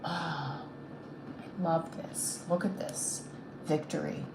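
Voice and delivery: very soft, near-whispered delight; barely voiced, slow wonder at a welcome sight; hushed awe. Real, not acted. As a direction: breathe it out quietly, moved. Moved by the card just drawn.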